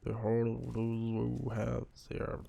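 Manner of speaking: put-on slow, mangled English accent; a hint of a broken English accent, drawn out; bad English accent. Gamer reading game text in bad English accent. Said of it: "mon accent anglais".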